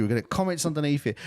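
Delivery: ordinary talk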